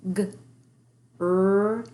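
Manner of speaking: G-R-E-N-H-O-U-S-E each sound said apart; teacher breaking up a blend for kids; segmenting sounds. Shown as a demonstration. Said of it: "Let's break up that blend".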